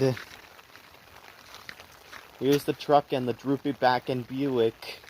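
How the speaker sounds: usual speaking voice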